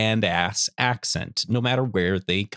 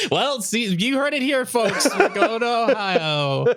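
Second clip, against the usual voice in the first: mock announcer; 12 semitones higher, slower than usual. Host teases listeners about the Ohio trip, in a mock announcer voice. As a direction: announce it to the folks like a showman.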